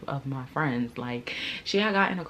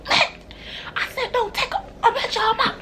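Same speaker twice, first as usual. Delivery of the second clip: much higher, loud shrill mimic; spazzing girl; 5 dB louder than usual.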